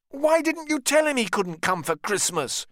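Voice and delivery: high, loud indignant cry. Acted. Richard confronts Ned about George at Christmas, high indignant cry. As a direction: demand it of him, hurt and angry. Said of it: "Richard cried".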